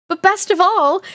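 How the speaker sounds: put-on high, giggly girl's line; excited, giggly character voice. A narrator's reading. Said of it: "Angeline giggled".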